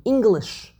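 English with ENG stressed, falling; English stressed on its first syllable. Shown as a demonstration. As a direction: hit the ENG in English, let -lish drop. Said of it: "emphasis is on the first syllable".

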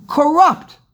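Corrupt. flung out high and loud; a single punched word, Corrupt; stress on corrupt. Acted. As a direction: hit Corrupt. hard and high. Preacher hammers the word corrupt.

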